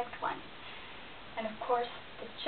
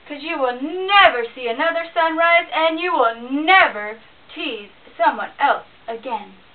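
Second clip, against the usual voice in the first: loud, menacing bear voice; angry bear threatening the squirrel it pinned; growling bear; 3 semitones higher, 12 dB louder than usual. Acted. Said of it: "growling at him".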